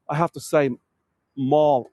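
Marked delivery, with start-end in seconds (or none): 1.3-1.9 first "mall" said as "mawl", long aw; North American "mall". Shown as a demonstration. Guide adapting to North American viewers, "mall" as "mawl". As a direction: say "mall" to rhyme with "hall". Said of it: "so many North Americans on here".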